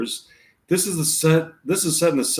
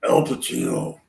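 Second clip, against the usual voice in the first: a much lower, altered Pacino voice; Al Pacino impression. Shown as a demonstration. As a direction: drop the voice right down to sound like Pacino. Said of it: "just trying to sound like him".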